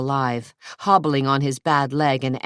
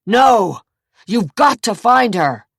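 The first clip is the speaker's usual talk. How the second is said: loud, higher plea; desperate insistence; 7 semitones higher, 8 dB louder than usual. A narrator's reading. Zach to the officers, desperate loud insistence. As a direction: shout at the police to search. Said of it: "Zach insisted".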